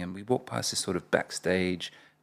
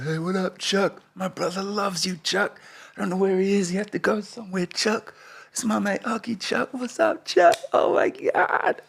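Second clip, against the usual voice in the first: high squeaky gushing, climbing ever higher; starstruck kid; 13 semitones higher, 3 dB louder than usual. Retold or imitated. Kid greeting his rapper idol, breathless excited gushing. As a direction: babble like an overexcited child, ending in a squeal.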